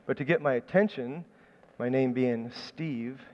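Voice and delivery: ordinary talk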